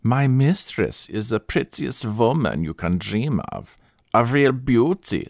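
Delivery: put-on persuasive German-accented sales pitch; thick German accent, praising with growing lift; strong German accent. A narrator's reading. German attendant selling her mistress to a Frenchman, strong German accent. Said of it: "with a strong German accent".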